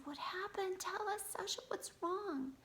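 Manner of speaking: high, worried questioning without raising volume; concerned parents. A narrator's reading. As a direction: ask anxiously, pitched well up. Parents run in, alarmed.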